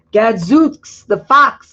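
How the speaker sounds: hammed-up angry farmer oath; farmer swearing. Farmer McCloskey swearing at the fox, hammed-up anger. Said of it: "McCloskey swears".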